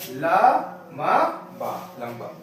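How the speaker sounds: "ला", "मा", "बा" with pauses between, then "लंबा" said whole; syllable by syllable. Shown as a demonstration. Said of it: "इसलिए हमने यहाँ पे पढ़ा".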